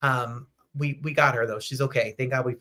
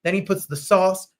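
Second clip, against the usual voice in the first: ordinary talk, nothing marked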